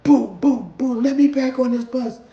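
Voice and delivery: yelling at bus door. Retold or imitated. Rider pounding the bus door, furious shout. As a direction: bang and demand to be let back on.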